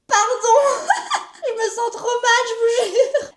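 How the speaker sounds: high giggly apology; laughing. Real, not acted. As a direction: laugh through the pain. Laughing while sick.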